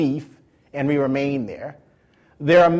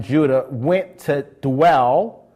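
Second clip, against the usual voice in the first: "dwell" drawn out slowly; stress on dwell; 2 semitones lower, much slower than usual. Shown as a demonstration. Preacher having the congregation underline "dwell", drawing it out. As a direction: linger on "dwell". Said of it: "underline the words dwell".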